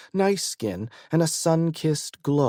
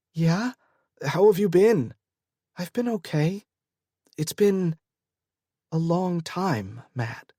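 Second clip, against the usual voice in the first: lightly voiced Grace; 5 semitones higher than usual.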